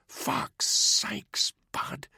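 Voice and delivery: exasperated whisper; whispered rebuke to a friend; whispered curse. A narrator's reading. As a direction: hiss it in a whisper so no one overhears. Secret talk on stairs.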